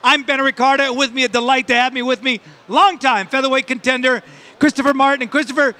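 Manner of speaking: ordinary talk, nothing marked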